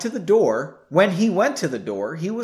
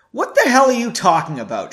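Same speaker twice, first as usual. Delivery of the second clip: louder, higher, indignant demand; raised voice; 5 semitones higher, 6 dB louder than usual. A narrator's reading. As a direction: raise your voice in disbelief. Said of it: "she asked in a raised voice".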